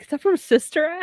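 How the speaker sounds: high, puzzled question. Retold or imitated. Host retelling her confusion about the song, puzzled. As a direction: ask it lost and baffled. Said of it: "and I'm like".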